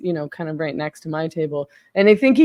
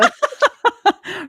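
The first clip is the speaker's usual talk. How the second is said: high-pitched laugh; laughing at the memory; 5 dB louder than usual. Real, not acted. Laughing again.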